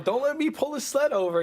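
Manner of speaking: a mock-stern Santa scolding the elves, pitched high; Santa as an angry dad. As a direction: threaten the elves like a fed-up father. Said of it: "you know, like that to the elves".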